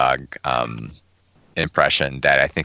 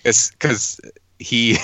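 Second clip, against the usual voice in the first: ordinary talk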